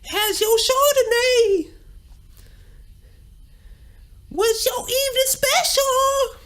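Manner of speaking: slow, very high rock wail. Pretend. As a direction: screech polite small talk like a rock singer. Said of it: "going to a fancy restaurant with him".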